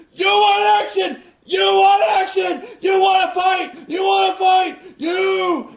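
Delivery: huge high shout, roaring each line; Flair shouting. Retold or imitated. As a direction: scream it like a wild man at the crowd. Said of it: "He's, he's like".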